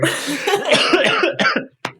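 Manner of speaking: sharp, high coughing fit from swallowing water; choking cough. Real, not acted. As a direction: cough hard as water goes down wrong. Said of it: "I'm choking on water".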